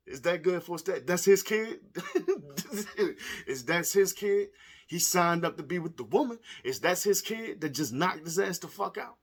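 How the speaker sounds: loud, high disbelief; incredulous. Real, not acted. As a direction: ask it like you can't believe it. Incredulous questions after laughing.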